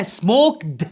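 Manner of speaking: "سموكد" slowly, with a voiced d after k; wrong d ending. Shown as a demonstration. Teacher asks if it is said so, voiced d. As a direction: say "سموكد" as smok-d. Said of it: "لا".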